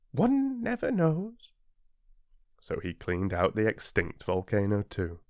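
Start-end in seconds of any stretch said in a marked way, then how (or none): 0.1-1.5 put-on high, slow, cautious boy; little prince shrugging off risk. A narrator's reading. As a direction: say it with a child's wisdom.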